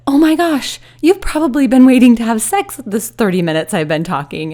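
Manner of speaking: wife teasing husband in bed, high excited exclamation. Retold or imitated. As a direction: burst out teasingly at your husband. Retelling her teasing remark to husband.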